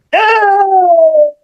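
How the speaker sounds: very high, hammed-up heartbroken cry; mock anguished wail. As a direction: wail like your hero just stabbed your heart. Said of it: "I was like".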